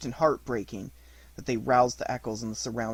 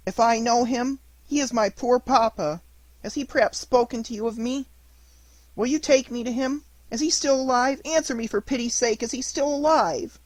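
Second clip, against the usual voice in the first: put-on high, pleading boy's voice full of grief; a lost little puppet begging his dead fairy to come back; weeping puppet boy; 10 semitones higher, 6 dB louder than usual. Pinocchio begs news of papa.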